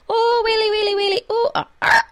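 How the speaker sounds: very high, loud wail; hammed-up high cry tailing into yelps; panicked wailing. A narrator's reading. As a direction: keep wailing on, oblivious. One Feagle keeps wailing alone.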